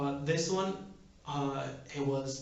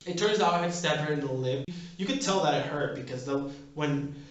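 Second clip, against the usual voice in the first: normal talking voice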